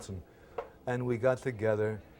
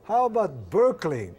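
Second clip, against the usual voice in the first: pointed loud question; 8 semitones higher, 9 dB louder than usual. Retold or imitated. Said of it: "I said".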